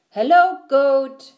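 high, loud hello; Duck calling out. A narrator's reading. Duck greets Goat while riding, calling out high.

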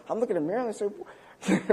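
ordinary talk, nothing marked

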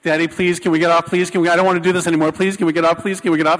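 loud, breakneck mock begging; imitated panicked screaming. Daughter suspended on the coaster, mock screamed pleading. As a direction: pour out frantic pleas without a gap, imitating a child. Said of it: "and she's screaming".

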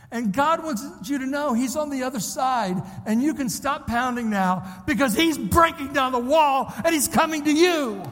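building to a loud, high climax; preacher swelling to a ringing declaration; impassioned preaching. Real, not acted. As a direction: proclaim the good news with mounting force. Preaches a prophetic word.

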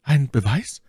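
high, lifted exclamation; exclaimed question. A narrator's reading. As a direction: call it out as an eager question. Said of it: "rief My Lady".

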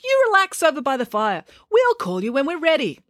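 high-pitched call across a distance. A narrator's reading. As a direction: call over reassuringly, high. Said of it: "called Georgia".

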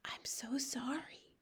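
slow, very soft whisper; whispered apology. A narrator's reading. Sophie apologises into the giant's ear, whispered. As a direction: whisper it contritely. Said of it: "Sophie whispered".

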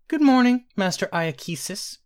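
high, carefully enunciated royal greeting; regal enunciation. A narrator's reading. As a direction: greet like a boy king, syllable by syllable. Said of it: "enunciating each syllable with a regal accent".

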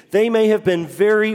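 ordinary talk, nothing marked

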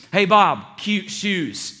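loud, teasing call-out; mocking humor. Someone jokes at Bob's expense before everybody, mocking. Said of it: "We do it with our humor".

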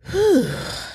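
long audible exhale; heavy sigh, getting it off the chest; venting sigh. Real, not acted. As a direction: blow out a big sigh after a rant. Relief after venting the rant.

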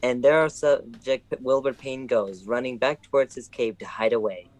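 mock nature-show voiceover; documentary narration. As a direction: narrate him like an animal fleeing.

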